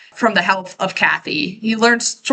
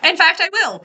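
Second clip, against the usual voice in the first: high-pitched defiant comeback; play-acted, higher-pitched retort; mock defiance; 9 semitones higher than usual. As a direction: toss it back in a raised mock-defiant voice. Acted defiant comeback in retold quarrel.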